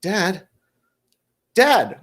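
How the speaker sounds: high-pitched call for Dad; questioning kid calling out to her father; daughter calling dad. Acted. Daughter checks if dad hears the tone, calling out.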